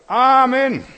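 very loud, high yelled amen; old brother yelling amen at the preacher; shouted amen. Retold or imitated. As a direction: bellow amen across the church. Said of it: "der schreit".

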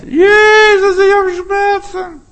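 high, loud plea, slow; crying out in pain. Pretend. Sick believer crying to Jesus, loud plea. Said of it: "Wenn du Krankheit hast".

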